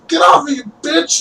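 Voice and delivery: high-pitched shouted outburst; angry protest. Acted. Necromancer to the townsperson grabbing his leg, angry high shout. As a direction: yelp it while being grabbed. Captive fighting off an attacker.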